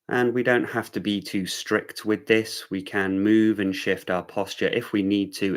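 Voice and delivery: unhurried meditation-teacher delivery; guided meditation. Real, not acted. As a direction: guide them gently into awareness, leaving room between phrases.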